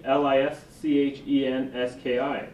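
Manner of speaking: slow letter by letter "L I S C H E N S K I"; spelling it out. Real, not acted. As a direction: spell each letter of "L I S C H E N S K I" clearly. Spelling a name for the record.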